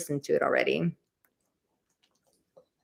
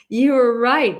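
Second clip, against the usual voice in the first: louder, higher with a laugh; 6 semitones higher, 8 dB louder, slower than usual. Real, not acted. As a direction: laugh it out, slowly. Laughing repeat to Maria.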